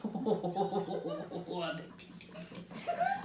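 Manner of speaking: long helpless fit of laughing; real laughter. Real, not acted. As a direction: crack up at your own line. Cracks up at the line.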